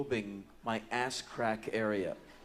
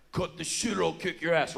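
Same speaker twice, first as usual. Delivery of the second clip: louder, higher threat cutting him off; angry threat; 4 semitones higher, 3 dB louder than usual. Retold or imitated. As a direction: play the big fella losing patience. Big fella threatens, cutting in.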